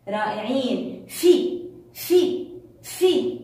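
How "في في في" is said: في as a short isolated syllable, higher pitched; letter sound demo. Shown as a demonstration. Teacher answering how the letter sounds, short repeated syllable. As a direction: repeat في crisply, a gap after each. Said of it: "كيف صوته يا أول؟".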